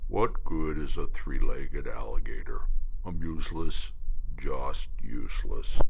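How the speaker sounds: low, whispered groan of self-pity; groaning despair. Acted. Wounded gator groans to the spoonbill, despairing. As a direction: sink into hopelessness. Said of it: "Stumpy groaned".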